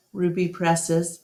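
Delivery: ordinary delivery, nothing marked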